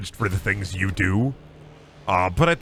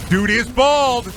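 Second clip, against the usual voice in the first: excited; 11 semitones higher, 5 dB louder, much slower than usual. Real, not acted. Gleeful about a bald hero.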